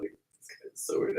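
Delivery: hushed, slow aside; whispered thought. Retold or imitated. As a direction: mutter it under your breath. Remembered inner thought, whispered.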